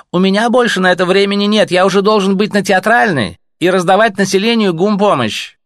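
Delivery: a bureaucrat brushing off visitors at night; cool Reich officer. Acted. Reich officer answering the messengers, officious.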